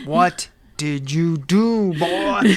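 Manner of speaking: slow, drawn-out mock scolding; stern father. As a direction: imitate a father stretching each accusing word. Father confronts guilty son.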